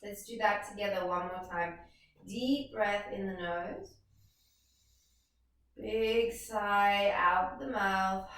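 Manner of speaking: slow, measured yoga-class cueing; guided breathing. Acted. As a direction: draw the words out to pace the breath. Leads a breathing exercise.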